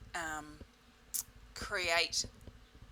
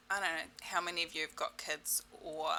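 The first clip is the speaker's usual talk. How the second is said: usual speaking voice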